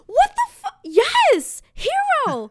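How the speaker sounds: very high, loud outburst; exasperated yell. Retold or imitated. Customer asked about the hero yet again, exasperated yelling. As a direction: blow up, each word pushed high and loud. Blowing up at deli guy.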